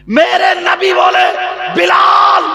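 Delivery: high, loud sermon cry; fiery preaching. Acted. Preacher calling Bilal's name at full force. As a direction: preach it out at the top of the voice.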